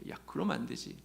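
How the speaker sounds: breathy private whisper; whispered to himself. Retold or imitated. Young man rejecting his own wish idea, hushed whisper. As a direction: mutter the thought under your breath.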